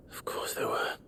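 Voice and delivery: weary whispered sigh. Acted. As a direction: breathe it out, resigned. Grim reaction to grim news.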